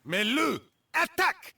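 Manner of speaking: high, loud yelled order; battle command shout. Acted. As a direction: scream the attack order to the beast. Commanding creature to attack.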